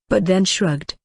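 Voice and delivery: normal talking voice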